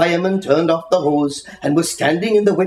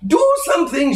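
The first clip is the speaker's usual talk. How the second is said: urgent demand; 12 semitones higher, faster than usual. A narrator's reading. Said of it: "she said".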